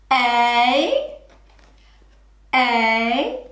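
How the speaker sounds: A said as a short separate sound, then again. Shown as a demonstration. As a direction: say A, pause, say a. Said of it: "Say train".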